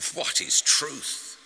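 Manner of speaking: loud, slow, high-pitched retort; scornful, stretched-out retort; sharp retort. A narrator's reading. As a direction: fling the question back, drawn out. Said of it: "retorted Pilate".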